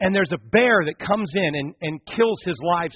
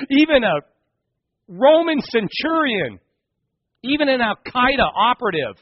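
raised, emphatic sermon delivery; impassioned preaching; 4 semitones higher, 4 dB louder than usual. Real, not acted. Preacher bringing it home to the congregation, impassioned. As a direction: drive the point home with high, weighty phrases. Preaches the point at full force.

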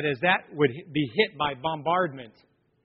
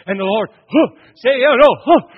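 loud, much higher preacher panting a huh after phrases; mock a preacher gasping huh between shouted words; emphatic-breath preacher; 7 semitones higher, 12 dB louder than usual. Mocking a preacher's emphatic breath to the congregation. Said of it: "the preacher did what's called an emphatic breath".

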